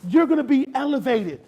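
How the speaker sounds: loud, high sermon exhortation; fired-up preaching. Real, not acted. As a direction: punch it out to the congregation with force. Preacher warns future leaders, fired up.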